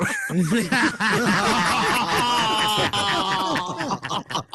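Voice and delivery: high burst of real laughter; a shout of a laugh. Real, not acted. Laughs at offensive-jokes compliment.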